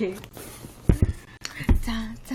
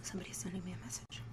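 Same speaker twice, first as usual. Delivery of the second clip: quick unvoiced whisper; hushed quick aside; 16 dB quieter, faster than usual. Real, not acted. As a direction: breathe it out fast to yourself. Aside while checking phone.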